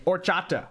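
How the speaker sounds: horchata with the H dropped, "or-CHA-ta"; silent H. Shown as a demonstration. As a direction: start horchata on the vowel, no H sound. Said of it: "Don't pronounce the H really".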